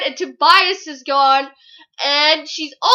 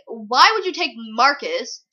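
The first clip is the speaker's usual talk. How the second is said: ordinary talk, nothing marked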